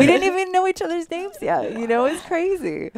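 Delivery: high, loud amazement; thrilled at how fast the group bonded; excited. Real, not acted. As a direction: burst out with disbelief. Excited retelling of magical moment.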